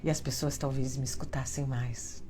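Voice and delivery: low, soft, half-whispered seductive tone; mock-sultry, breathy and hushed, played for laughs; sexy voice. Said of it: "nesse tom de voz que eu acho mais sexy". (voz sexy sussurrada, de brincadeira)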